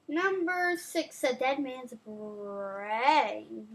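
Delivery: slow, low spooky title; spooky announcer. Acted. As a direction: drag it out like a horror host. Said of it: "announces scary title".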